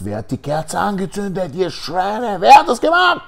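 insulting them, rising to a scream; angry yell. Acted. Guard demanding who lit the candle, angry yell. Nazi guard interrogating prisoners.

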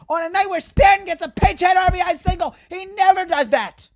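venting fan shouting about the loss; angry shouting. Real, not acted. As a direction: rage at the wasted chance. Angry rant peak.

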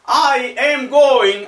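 very slow, high, loud teaching call; drawn-out, projected example; loud model sentence. Shown as a demonstration. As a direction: stretch each word out for learners.

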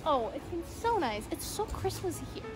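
ordinary delivery

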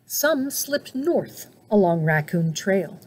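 plain reading voice

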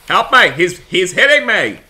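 high, loud panicked cry; mock frightened yell. Monster hitting her, hammed-up cries for help. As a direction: yell for help as he hits you.

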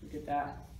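plain reading voice

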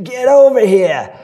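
put-on high, loud, slow Maine accent calling him over with a laugh; eager Maine accent. Maine voter calls him over to sign, in an eager laughing Maine accent.

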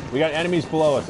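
loud alarmed callout; urgent warning shout. Real, not acted. Warning teammate of enemies.

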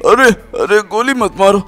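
high, loud yelps of pain; hammed-up cries of pain and panic; panicked begging. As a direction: plead loudly as if being shot. Captive being shot begs attackers.

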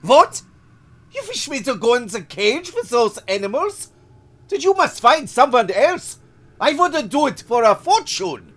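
shrill furious legionary yelling his refusal; violent outburst. Acted. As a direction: explode at the boss. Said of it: "he blurted out violently".

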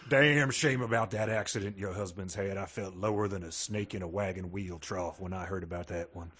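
low, folksy Texas drawl. Pretend. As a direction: talk like a weathered cowboy uncle. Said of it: "He's got this slow Texas drawl".

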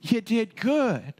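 high, slow, delighted wow; high delighted praise; impressed master. Acted. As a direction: beam with pride. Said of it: "He's like".

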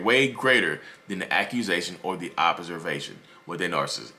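usual speaking voice

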